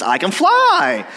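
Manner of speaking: high, loud, drawn-out cartoon cry; flying donkey. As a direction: crow it slowly, stretching the last word. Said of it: "he starts flying, and he says".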